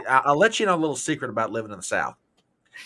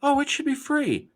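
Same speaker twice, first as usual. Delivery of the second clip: high mocking naive voice; 14 semitones higher than usual. Mocking people wanting free things, high mimicry. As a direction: parody the naive believer. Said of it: "It's like".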